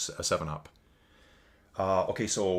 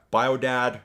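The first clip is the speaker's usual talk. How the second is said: normal talking voice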